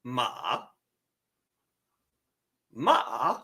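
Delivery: "ma" starting low and climbing; rising "ma", bottom to top; second tone. Shown as a demonstration. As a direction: say "ma" as if asking a question. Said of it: "down here and raises up".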